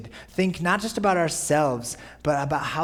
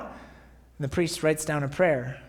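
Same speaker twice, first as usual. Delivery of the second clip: ordinary talk, nothing marked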